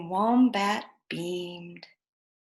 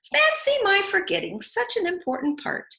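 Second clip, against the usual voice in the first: surprised Emu; 10 semitones higher, 4 dB louder than usual. Acted. Emu exclaims at her mistake.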